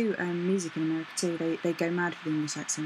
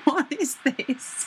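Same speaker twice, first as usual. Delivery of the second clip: high, drawn-out disbelief; mock astonishment; 8 semitones higher, 3 dB louder, much slower than usual. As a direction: stretch it out in playful shock. Recalling shock at seeing the interview.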